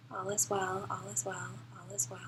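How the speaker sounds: very soft, fading almost to a whisper; soothing mantra. Shown as a demonstration. Telling your body all is well, hushed soothing mantra.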